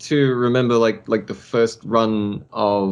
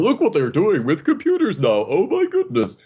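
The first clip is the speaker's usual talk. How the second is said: high-pitched marvelling quote; mock amazement; 6 semitones higher than usual. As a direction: act out wide-eyed wonder at something new. Voicing amazed early computer-animation viewers.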